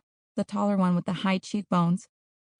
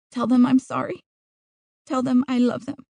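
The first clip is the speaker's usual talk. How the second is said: put-on higher-pitched pleading girl; begging; 5 semitones higher, 3 dB louder than usual. Trapped girl asks others to tell her parents, begging. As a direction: plead with them in a raised voice. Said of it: "She begged them to remember".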